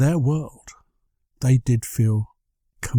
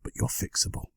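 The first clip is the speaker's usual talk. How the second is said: soft unvoiced whisper; 11 dB quieter than usual. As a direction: breathe it as a secret. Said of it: "Then I come in and say".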